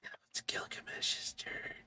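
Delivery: breathy whispered aside to the table; soft whispered game master aside. Real, not acted. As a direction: lean in and whisper the turn order.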